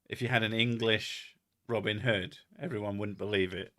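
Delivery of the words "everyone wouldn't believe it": ordinary delivery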